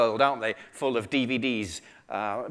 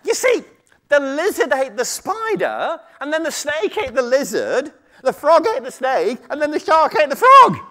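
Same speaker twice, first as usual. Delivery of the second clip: high, urgent little creature; muskrat voice; 12 semitones higher, 7 dB louder than usual. Acted. Muskrat holding the tiger off with more story, high squeaky voice. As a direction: reel it off high, getting louder. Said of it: "shouted the muskrat".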